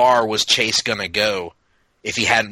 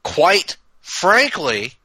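stretched slow and higher; slow drawn-out; 7 semitones higher, much slower than usual. Shown as a demonstration. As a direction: draw each word out for effect. Repeats phrase for effect.

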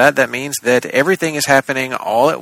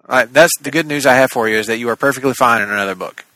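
ordinary delivery

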